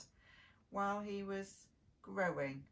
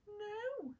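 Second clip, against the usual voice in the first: sad; 10 semitones higher, 4 dB quieter than usual. Acted. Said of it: "said the ugly duckling sadly".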